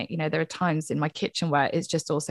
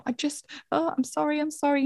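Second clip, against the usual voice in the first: flustered apology; 10 semitones higher than usual. Retold or imitated. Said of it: "you might then wanna say".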